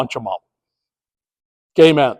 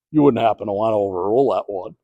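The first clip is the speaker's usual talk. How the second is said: quiet, quick sly request, voice much lower; sly hushed ask; 10 semitones lower, 10 dB quieter, faster than usual. Retold or imitated. Coach leaning in to the umpire, hushed and sly. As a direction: coax the umpire softly. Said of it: "he puts his arm around me and he goes".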